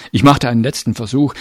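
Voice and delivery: plain reading voice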